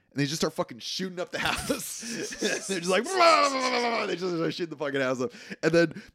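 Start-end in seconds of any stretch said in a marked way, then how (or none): 3.0-4.1 high shouted gunfire noises; spray it like a tommy gun; mock gunfire. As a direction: blah blah gun sounds, shouted. Imitating gunfire.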